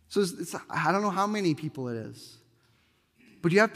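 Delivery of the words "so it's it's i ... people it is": usual speaking voice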